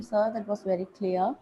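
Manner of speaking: ordinary delivery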